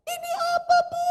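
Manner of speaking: high-pitched astonished asking; retold bewilderment in a high voice; startled question, imitated. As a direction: pitch it way up as the surprised woman. Woman startled by the money.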